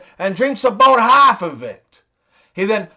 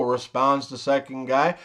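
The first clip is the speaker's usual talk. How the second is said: normal talking voice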